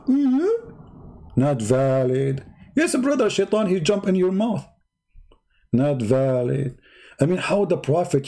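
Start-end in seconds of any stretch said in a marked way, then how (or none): 0.1-0.7 squeaky high mm-hmm; mm-hmm pitched way up; high mock mm-hmm. Mocking high affirmation. 1.3-2.4 slow, drawn-out not valid; slow mock verdict. 2.7-4.6 high mock pious voice; mock believer. 5.7-6.8 slow, drawn-out not valid; slow mock verdict.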